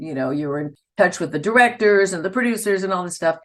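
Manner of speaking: ordinary talk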